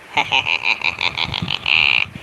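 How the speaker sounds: deep, drawn-out staccato laugh; low rattling cackle; low slow chuckle. Pretend. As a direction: laugh very low and soft, pulse by pulse.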